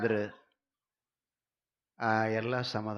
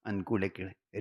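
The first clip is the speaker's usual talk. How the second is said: ordinary talk, nothing marked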